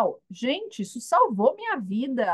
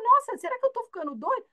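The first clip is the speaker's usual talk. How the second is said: high, fast and fretful; a high, quick worried squeal; mock panicked self-doubt; 11 semitones higher, faster than usual. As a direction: squeak it out as if losing your mind. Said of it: "Aí você fala".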